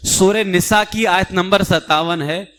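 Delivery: usual speaking voice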